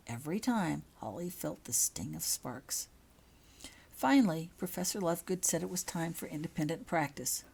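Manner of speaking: ordinary delivery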